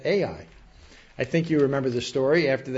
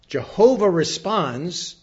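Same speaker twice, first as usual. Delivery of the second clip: normal talking voice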